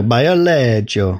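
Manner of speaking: put-on Bio Lego said as BEE-oh LEH-jo, Italian accent; lightly Italian Bio Lego, rolled and slow. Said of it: "I was trying to read it in an Italian accent".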